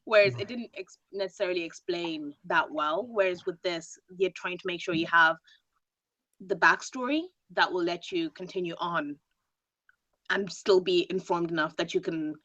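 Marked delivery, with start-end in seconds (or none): none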